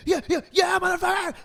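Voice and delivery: high, loud furious yell; mock angry yell. The angry driver yelling at him, in a loud mock rage. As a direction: scream it like a raging hothead.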